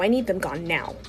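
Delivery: ordinary delivery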